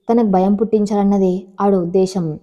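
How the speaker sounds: usual speaking voice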